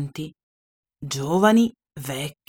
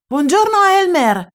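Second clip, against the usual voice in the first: bright, much higher happy hello; cheerful greeting; 10 semitones higher, 4 dB louder than usual. Acted. Jungle animals happily greeting Elmer, high and cheerful. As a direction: greet your friend with delight. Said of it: "Tutti lo salutavano contenti".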